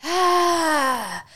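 long high, loud "ahh"; loud reenacted sigh. Diner reliving the burn from the nacho plate, loud sighing cry. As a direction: let out a big, high breathy cry. Acting out reaction to spicy fumes.

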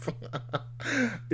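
a burst of high, breathy laughter. Real, not acted. Laughing hard at his friend's savory line. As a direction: crack up at the quote. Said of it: "that shit is hilarious".

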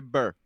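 "burr" as one short r-coloured syllable; shortened to BURR. Shown as a demonstration. Showing the young generation's version, "burr" with an r-coloured vowel. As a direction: cut it down to "burr", rhyming with fur. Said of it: "short, shortened it to".

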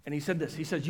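ordinary talk

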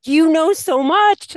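high, slow, loud praise; delighted praise. Retold or imitated. Teacher praising her nonverbal student, gushing. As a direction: gush over him like a proud teacher.

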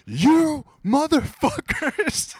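high, slow, strained mock scream; retold scream. Retelling a stranger screaming at shoppers, high and slow. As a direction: shriek it out like a man losing it. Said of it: "one dude just starts screaming at everybody".

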